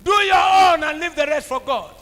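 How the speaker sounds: loud sermon exhortation; shouted exhortation. Real, not acted. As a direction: charge them to trust God. Preacher exhorting congregation.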